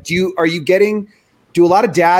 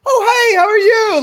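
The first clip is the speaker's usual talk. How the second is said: much higher, louder, sugary phone greeting; sweet phone voice; 5 dB louder than usual. Retold or imitated. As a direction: switch to fake-nice for a friend on the phone. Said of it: "Like, just totally changed".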